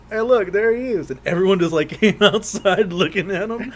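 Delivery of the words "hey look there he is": excited call. Retold or imitated. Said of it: "I remember I was like".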